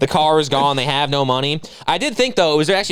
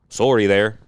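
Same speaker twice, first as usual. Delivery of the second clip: low-pitched Canadian apology, rounded vowel; Canadian accent; 5 semitones lower, slower than usual. Retold or imitated. Mimicking a Canadian's apology, Canadian accent. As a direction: imitate a Canadian saying sorry, SORE-ee. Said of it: "American accents to Canadians".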